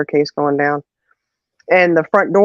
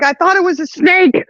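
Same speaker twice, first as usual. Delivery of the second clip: high, exclaimed mock fright; acted-out squeal of alarm; imitated frightened mom; 13 semitones higher than usual. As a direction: blurt it out as a panicked excuse. Imitates mom's frightened excuse.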